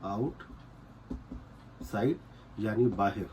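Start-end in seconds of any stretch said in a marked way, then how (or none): 0.0-2.2 very slow, breathy whisper of outside; slow whispered demo. Shown as a demonstration. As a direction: whisper outside slowly, drawing out each sound. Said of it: "गाई का मतलब होता है outside". (Outside को बहुत धीरे फुसफुसाकर खींचना)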